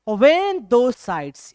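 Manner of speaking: very slow, drawn-out recital of the line; slow poem reading. Shown as a demonstration. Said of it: "तो कवि कहते हैं".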